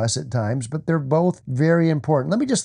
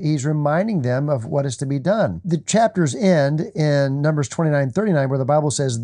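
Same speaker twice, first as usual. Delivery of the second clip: normal talking voice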